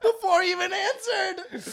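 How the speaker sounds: very high squeal through laughter; real laughing shriek; helpless laughter. Real, not acted. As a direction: barely get it out, cracking up. Cracking up at retold story.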